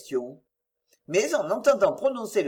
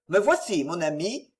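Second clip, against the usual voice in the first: ordinary delivery, nothing marked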